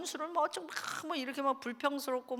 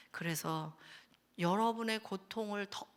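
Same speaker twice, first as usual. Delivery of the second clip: usual speaking voice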